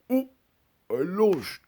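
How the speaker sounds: tiny high-pitched hare voice; mock cartoon voice, way up high; losing hare. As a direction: squeak it out as the beaten hare.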